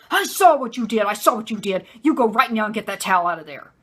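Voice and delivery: loud, forceful imitation of a furious dad; imitated angry scolding, punched out loud; angry husband yelling. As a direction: come at them with a hard, loud accusation. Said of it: "he came after her just like this".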